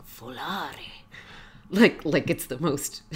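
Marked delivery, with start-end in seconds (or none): 0.1-0.9 soft, dreamy, confused murmur. Retold or imitated. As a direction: say it as if gazing off, puzzled. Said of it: "dreamily and confusedly looks out the window".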